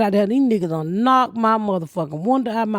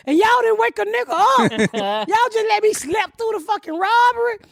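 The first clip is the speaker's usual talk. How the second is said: mock indignant shout; 9 semitones higher than usual. Retold outburst at police.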